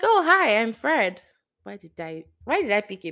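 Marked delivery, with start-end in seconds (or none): 0.0-1.2 high, loud greeting; acted cheery introduction. Introducing oneself to someone just met, acted high and loud. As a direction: swoop up high on the hello, then settle.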